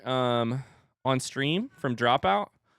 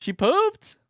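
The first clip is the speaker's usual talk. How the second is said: high, loud, cutesy exclaiming; playful parent squeal; 6 dB louder than usual. Real, not acted. Playful talk to own toddler.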